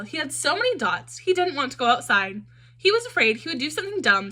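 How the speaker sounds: ordinary delivery, nothing marked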